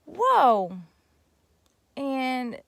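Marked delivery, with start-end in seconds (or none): 0.1-0.8 amazed. Real, not acted. Reacting to jail for sleeping.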